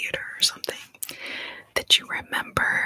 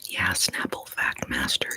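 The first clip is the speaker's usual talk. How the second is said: usual speaking voice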